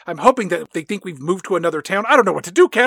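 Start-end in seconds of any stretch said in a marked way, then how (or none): 2.1-2.9 raised, louder hammed-up despair; mock panic. Dad confessing the debt to Kat, in hammed-up panic. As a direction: overplay a frightened dad at a loss.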